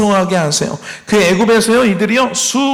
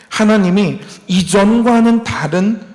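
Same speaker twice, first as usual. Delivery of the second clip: ordinary delivery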